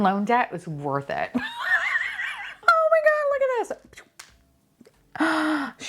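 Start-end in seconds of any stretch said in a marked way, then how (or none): 1.4-2.7 high squealing laugh of joy; delighted shriek laugh. Real, not acted. Opening blind box, lucky pull. 2.7-5.9 excited squeal. Real, not acted. Excited reaction to lucky pull.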